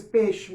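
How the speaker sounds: peixe said as pexe, the i dropped; casual spoken peixe, ei flattened to e; reduced diphthong. Shown as a demonstration. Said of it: "a gente acaba não pronunciando o i".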